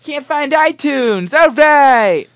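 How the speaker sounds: very high, loud, drawn-out shouting; over-the-top hype. Pretend. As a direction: keep cheering through the hitch. Hyped shouting while finding music.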